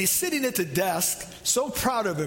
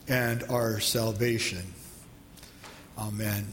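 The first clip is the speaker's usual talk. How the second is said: low, measured prayer to open the sermon; 6 semitones lower than usual. Acted. Pastor prays before the sermon, in a reverent prayer voice.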